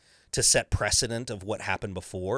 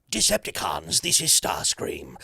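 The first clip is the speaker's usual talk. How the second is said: Starscream voice; 5 semitones lower than usual. Shown as a demonstration. Actor improvising the Starscream voice for the director. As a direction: hiss it out like a sly robot villain. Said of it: "That's just what I decided".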